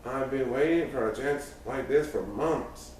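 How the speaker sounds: plain reading voice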